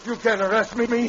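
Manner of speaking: loud, high furious protest; angry defiance. Acted. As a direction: shout the defiance. Villain defies arrest.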